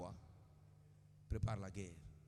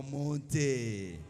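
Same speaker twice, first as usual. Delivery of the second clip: louder, drawn-out call; raised voice on a long final vowel; 8 dB louder than usual. Shown as a demonstration. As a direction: call it out for the crowd to echo. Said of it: "dis à haute voix".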